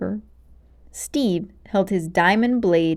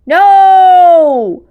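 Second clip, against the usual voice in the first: very loud, high cry of no; defeated witch screaming no, much louder; anguished cry; 10 semitones higher, 15 dB louder than usual. Acted. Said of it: "Malgosia cried".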